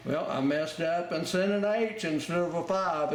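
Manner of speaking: normal talking voice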